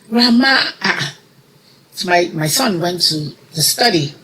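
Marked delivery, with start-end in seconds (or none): 0.1-0.5 high call, a little less loud; children calling grandma a second time; calling again. Retold or imitated. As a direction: call out once more, searching.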